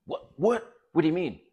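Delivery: a partner called selfish, sputtering in mock outrage; mock indignant what. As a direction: imitate her shocked, offended disbelief. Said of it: "She'll go".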